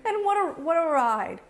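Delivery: ordinary delivery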